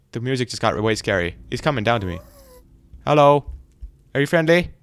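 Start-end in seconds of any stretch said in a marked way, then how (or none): none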